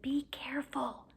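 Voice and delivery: soft unvoiced whispered warning. Hermione warns Ron in a whisper near the sleeping teacher. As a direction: hush him so the teacher won't wake. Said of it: "hissed Hermione".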